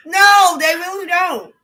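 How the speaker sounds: loud emphatic no; shouting. Real, not acted. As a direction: shout the denial. Loud retort to critics.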